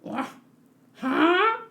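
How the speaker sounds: ordinary talk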